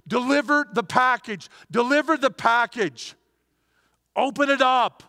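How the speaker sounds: loud, driving call to deliver the package; full-force preaching. Real, not acted. Preacher urging the congregation at full force to share Jesus.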